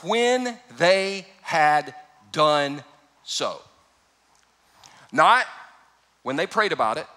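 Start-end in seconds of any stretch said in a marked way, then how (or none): 0.0-3.6 drawn out very slowly, word by word; much slower repeat. Shown as a demonstration. Preacher repeating the five words for emphasis, very slow. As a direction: stretch it out even further. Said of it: "These five words".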